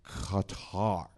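Qatar overdone, low, with a careful native-style first sound; mock a showily correct Qatar; overpronounced. Panelist mocking newsreaders who overpronounce the country, overpronounced. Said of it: "they're really like".

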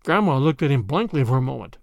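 ordinary delivery

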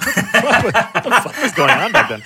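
high, quick, laughing disbelief; real laughter running through the question. Real, not acted. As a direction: crack up while asking. Laughing at old movie accents.

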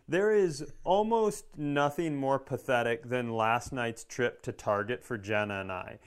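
ordinary talk, nothing marked

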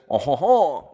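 hammed-up French chuckle; French accent. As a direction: stock Frenchman's "oh ho ho". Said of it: "we are not doing the French accent".

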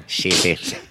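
South African accent, vowels flattened; a quick South African accent impression. Shown as a demonstration. South African accent for the talk show crowd. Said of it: "That's my impression of a South African accent".

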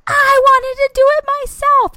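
put-on very high, loud kid scream; imitated screaming tantrum; hysterical child tantrum. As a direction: wail it like a toddler melting down. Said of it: "started hysterically crying".

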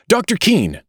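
high, urgent hail across the street; calling out. A narrator's reading. Mister Button hails the family physician, calling out. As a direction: call out to catch someone. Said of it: "he called".

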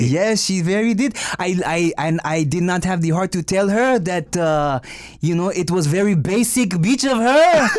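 hesitant put-on French accent. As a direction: confess as a mock Frenchman. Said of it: "we are talking in French".

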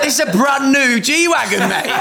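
high, loud and laughing outrage; mock outrage at his girlfriend over the car; indignant laughing protest. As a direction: protest through laughter, half joking.

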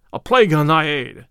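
mildly angry, forceful rebuff; angry cry. A narrator's reading. Sore Robin refuses Little John's help, angrily. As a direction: reject the help with a touch of temper. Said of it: "cried Robin angrily".

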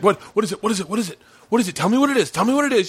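rapid, rising frantic demands; acted panic. Husband fears one of the kids died, mock-panicked pleading. Panicked questioning of crying wife.

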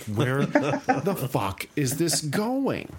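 slow, drawn-out stunned question; stunned disbelief. Retold or imitated. Reader up late at night, slowly stunned by the comic. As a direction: let the disbelief stretch each word.

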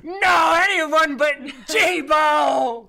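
high hammed-up wail of grief; mock wailing. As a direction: wail as if heartbroken, overdone. Mock grief over dead robot.

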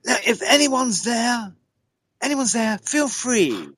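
high, drawn-out call to the spirits; retold high-pitched summons; mock shouting to spirits. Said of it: "shouted out".

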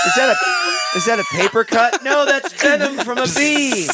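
high mock-alarmed voice, slowing at the end; stung audience member. Audience member discovering the bee sting, hammed-up alarm. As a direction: act out a shocked concertgoer.

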